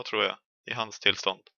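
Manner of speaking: ordinary talk, nothing marked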